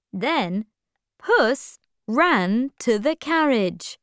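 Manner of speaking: ordinary delivery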